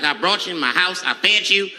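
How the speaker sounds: lightly voiced wounded host; a hint of indignant [name]. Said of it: "Mike must be confused, like".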